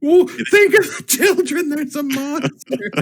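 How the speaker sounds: high-pitched hammy wail, laughing; mock alarm. Player hiding from a monster, crying out in high-pitched mock alarm.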